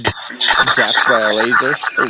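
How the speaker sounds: loud, slow, startled outburst; loud surprised. Real, not acted. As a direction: blurt it out in shock. Startled by game event.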